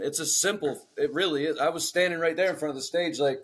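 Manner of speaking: usual speaking voice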